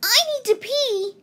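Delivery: high, quick little rabbit. A narrator's reading. Rabbit urgently needing the toilet, high and quick. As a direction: squeak it out high and hurried. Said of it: "said the rabbit".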